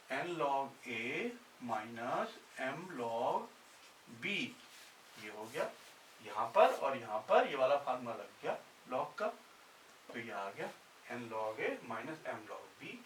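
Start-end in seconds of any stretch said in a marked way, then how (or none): 0.1-4.3 slow dictation. Shown as a demonstration. Teacher dictating formula slowly. 11.1-13.0 slow dictation. Shown as a demonstration. Teacher dictating formula slowly.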